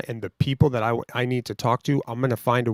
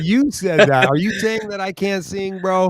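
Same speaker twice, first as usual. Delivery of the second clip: mock offended; 6 semitones higher, 4 dB louder than usual. Real, not acted. Cohost jokes he can't sing.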